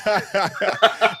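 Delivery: high laugh bursting out; laughter. Real, not acted. Host laughs at the octave joke.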